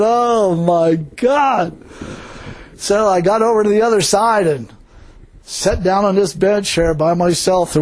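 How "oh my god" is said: long, drawn-out groan of dismay; exasperated agony. Retold or imitated. Preacher retelling his dread of the painful shoe, exasperated agony. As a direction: stretch each word out, very slowly. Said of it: "I was thinking".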